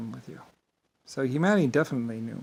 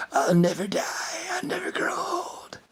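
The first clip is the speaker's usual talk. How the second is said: high, thin put-on voice, slowing; imitating Mister "I'll never die". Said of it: "and Mister".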